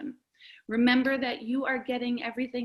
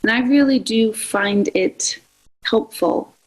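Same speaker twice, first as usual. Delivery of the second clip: guided meditation; 13 dB louder than usual. Acted. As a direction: ease them gently into breath awareness, unhurried. Begins leading guided breathing meditation.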